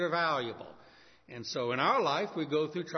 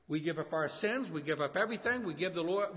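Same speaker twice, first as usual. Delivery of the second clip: usual speaking voice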